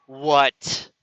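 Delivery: snapped loud one-word greeting; loud irritated phone answer; curt loud "What?". Retold or imitated. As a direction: bark it like an annoyed ex picking up.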